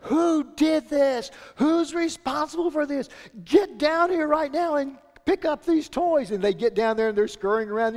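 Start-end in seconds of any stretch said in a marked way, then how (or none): 0.0-6.3 yelling. Retold or imitated. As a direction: bellow at the kids over the toys. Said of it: "you just start yelling".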